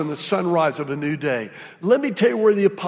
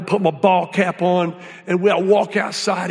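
normal talking voice

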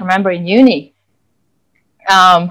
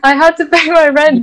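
protesting; 7 semitones higher than usual. Retold or imitated. Employee refusing the boss's offered day off, higher protest. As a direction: push back as if you really need the shift. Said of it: "I remember I was like".

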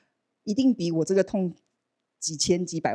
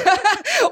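a sudden high bright laugh; burst of laughter; 6 dB louder than usual. Real, not acted. Laughing at how sure she was of healing. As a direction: laugh at your own confidence. Laughing at her own certainty.

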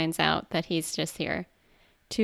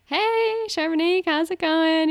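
bright gushing greeting; 8 semitones higher, 5 dB louder than usual. Retold or imitated. Eliza's gushing phone greeting.

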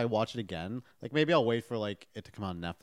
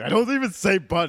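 much higher and louder indignant protest; triggered friend; 9 semitones higher, 9 dB louder than usual. Retold or imitated. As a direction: play the offended friend, pitched up. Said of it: "he's like".

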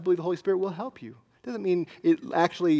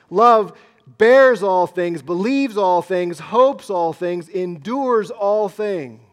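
slow and much louder, bears, believes, hopes, endures hammered; proclaimed loudly; 8 dB louder, slower than usual. Shown as a demonstration. Pastor proclaiming the verse to the church, loud and slow. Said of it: "Those are huge statements".

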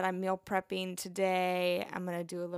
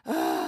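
deep groaning sigh; 6 dB louder than usual. Real, not acted. Can't go to concert.

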